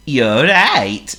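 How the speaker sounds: high, loud, slow seal voice; Honk the seal. Acted. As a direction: play a polite silly seal. Honk's voice.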